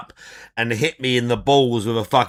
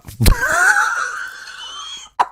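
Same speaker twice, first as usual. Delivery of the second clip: high shrieking laugh; 3 dB quieter than usual. Real, not acted. Laughing at own bit.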